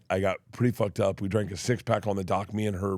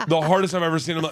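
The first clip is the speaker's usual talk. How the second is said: normal talking voice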